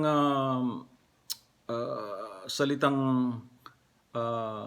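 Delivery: ordinary talk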